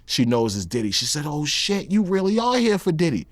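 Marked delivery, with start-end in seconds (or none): 1.2-3.3 put-on high girl's voice climbing into eager excitement; excited ex-girlfriend. Ex-girlfriend begs to meet [name], in a high, eager girl's voice. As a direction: play the star-struck ex begging to meet him.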